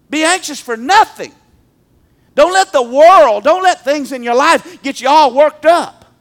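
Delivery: loud pleading preacher call; preaching loud. Acted. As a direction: drive the warning home. Preaching at full force.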